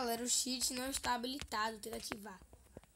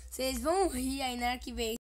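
usual speaking voice

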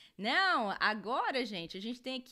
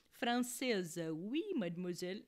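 mock French; 4 semitones lower than usual. Jokes about being French.

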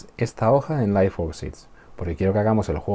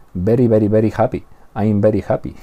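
put-on loud Spanish-accented English, "beri" for very; acento español; 2 semitones higher, 6 dB louder than usual. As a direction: imitate a Spaniard saying very with a B. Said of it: "cuántos españoles hemos oído decir". (inglés con acento español, very como "beri", fuerte)

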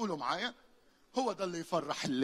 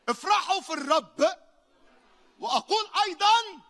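high, loud rejoicing cry; full-force preaching; 12 dB louder than usual. Acted. Preacher rallies the congregation to rejoice, high and loud. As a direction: lift the whole hall with joy.

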